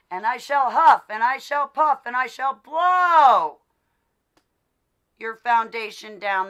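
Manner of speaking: hammed-up wolf menace; big bad wolf. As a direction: chant it like the wolf at the door.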